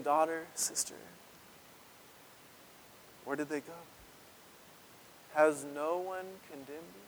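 soft, gentle voice; Jesus softly comforting the woman; tender Jesus. Acted. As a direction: speak gently to the shamed woman. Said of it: "tenderness in Jesus' voice".

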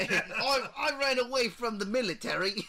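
put-on high, loud British accent through laughter; hammed-up, giggly British impression; mock British accent. Riffing on the actor as a deserter, mock British accent. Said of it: "him talking in a British accent".

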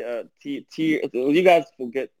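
normal talking voice

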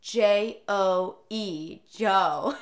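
slow, letter-by-letter "J O E", then "Joe"; spelling it out. Retold or imitated. Pete spelling dog's name for stranger, slow and deliberate. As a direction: spell "J O E" slowly for someone confused, then say "Joe". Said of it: "And Pete said".

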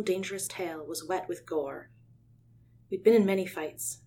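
ordinary delivery

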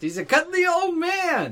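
high, drawn-out adoring coo; gushing. Real, not acted. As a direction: gush slowly over someone adorable. Gushing over a beloved old character.

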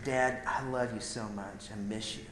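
soft, low, heartfelt words; tender confession. Retold or imitated. Son telling his dad he loves him, soft and tender. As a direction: say it quietly, as a tender admission.

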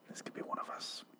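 very soft voiceless whisper; hushed, imitated zombie aside. Voices zombies sniffing a grave.

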